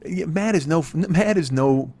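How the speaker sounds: ordinary talk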